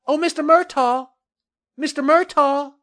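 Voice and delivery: high, loud singled-out hail; woman calling out. A narrator's reading. Woman hailing the developer from the hill, calling out. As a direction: call from far up the hill, high. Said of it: "a woman's voice called from up the hill".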